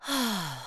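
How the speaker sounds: long sigh escaping; helpless sigh. Acted. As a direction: let out a held-back sigh. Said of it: "this time I can't keep it back".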